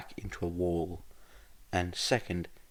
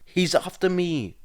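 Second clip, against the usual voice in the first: high, loud panicked yell while running; yelled back; 11 semitones higher, 9 dB louder than usual. Acted. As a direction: shout it back, winded from running. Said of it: "Izuku yelled back, already out of breath".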